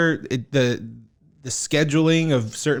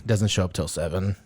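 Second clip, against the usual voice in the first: ordinary talk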